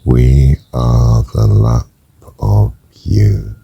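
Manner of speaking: very slow, spread over each breath; slow breath prayer. Shown as a demonstration. Praying to God in time with the breath, very slowly. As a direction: stretch the words out to fill a breath. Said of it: "Or we could do it with the".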